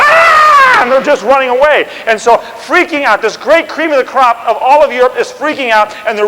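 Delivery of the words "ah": high, loud fleeing scream; a soldier's terrified yell; panicked scream. Retold or imitated. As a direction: soldiers screaming as they flee. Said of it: "dropping their guns and go".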